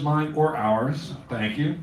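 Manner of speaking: ordinary delivery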